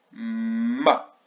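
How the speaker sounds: MA with a long hummed M; syllabe isolée. Shown as a demonstration. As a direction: hold the M, then release Ma. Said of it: "à la deuxième". (« Ma » avec un M longuement tenu)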